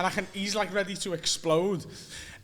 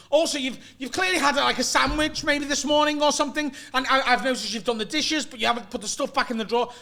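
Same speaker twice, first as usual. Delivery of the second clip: higher-pitched mock nagging; kicking off; 6 semitones higher than usual. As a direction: list grievances like a fed-up flatmate. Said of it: "so he was kicking off about that".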